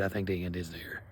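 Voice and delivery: a low, soft half-serious admission; plain quiet aside. Retold or imitated. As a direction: drop it down, matter-of-fact. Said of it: "but, like, dude".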